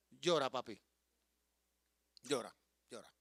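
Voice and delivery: put-on gently telling the boy it's okay to cry; tender comfort. Comforting grieving grandson.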